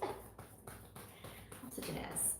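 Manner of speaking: breathy unvoiced self-mocking whisper; whispered aside. Real, not acted. Reader mocking herself in a whispered aside. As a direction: whisper it to yourself.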